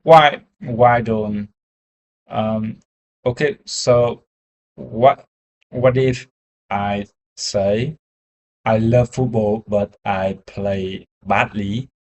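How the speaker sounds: usual speaking voice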